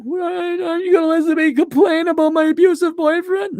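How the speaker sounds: high, put-on mocking voice; mock incredulous. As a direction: ask it in a very high mock whine. Mocking complaints about boyfriends.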